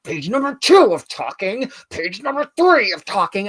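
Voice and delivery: high, slow, seething count; acted angry reading. Reader re-enacting reading page after page of talk, angry. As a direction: strain each page number upward in exaggerated fury. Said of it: "this was how angry I was reading it".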